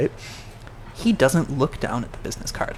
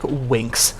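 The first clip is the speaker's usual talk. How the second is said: ordinary delivery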